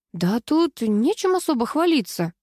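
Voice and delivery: usual speaking voice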